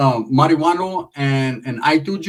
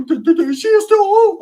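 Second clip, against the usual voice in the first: very high, loud cartoon voice; squeaky put-on concession; 5 dB louder than usual. Cousin conceding the point, loud squeaky put-on voice. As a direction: squeal it in an exaggerated impression.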